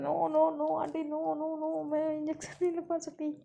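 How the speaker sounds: high, loud frantic protest; panicked refusal. Acted. Lovely begs mom and aunt to skip the injection, high panicked pleading. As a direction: panic like a kid dreading the needle.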